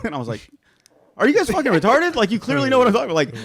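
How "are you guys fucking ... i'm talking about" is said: loud, high, fast outraged yell; furious teen yelling at his friends; angry yell. Retold or imitated. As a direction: shout it at them in disbelief. Yelling at friends who took hat.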